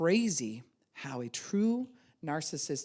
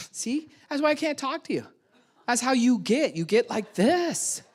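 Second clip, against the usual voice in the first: high, louder accusing tone, slowing at the end; blaming retort; 9 semitones higher, 7 dB louder than usual. Retold or imitated. Gaslighter blaming escalating partner, raised accusing voice. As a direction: turn it back on them.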